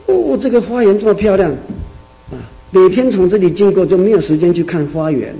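high and loud, delighted wonder; man amazed at the garden he never saw, delighted. Retold or imitated.

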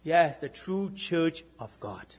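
ordinary delivery

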